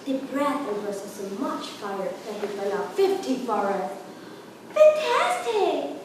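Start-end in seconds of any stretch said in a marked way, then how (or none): 4.7-6.0 high, slow, drawn-out fantastic; excited praise. Acted. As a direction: flatter the dragon with delight. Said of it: "said Elizabeth".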